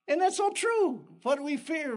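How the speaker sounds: ordinary talk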